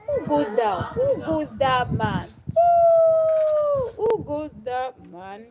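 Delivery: high, loud, drawn-out challenge; father challenging a visitor. Acted. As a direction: call out suspiciously, stretching the words. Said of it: "the father said".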